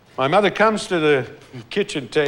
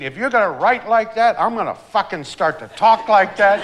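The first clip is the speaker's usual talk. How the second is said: higher, louder mock screamed threat, laugh breaking in; retold mother screaming; 8 semitones higher, 5 dB louder than usual. Mother scolding her son over his story, loud mock scream. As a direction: yell it like a fed-up mom who has had enough. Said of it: "my mother is now screaming".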